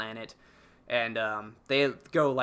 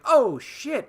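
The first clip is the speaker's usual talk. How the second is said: high, louder gasp of discovery in words; imitated realization; 12 semitones higher, 6 dB louder than usual. Crew figuring out who sent the signal, mock amazed. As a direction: act out a sudden dawning.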